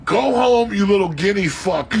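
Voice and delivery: high, loud yelled order; mock angry yell. Father telling the boy to go home, mock yelling. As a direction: yell it like an enraged father retold.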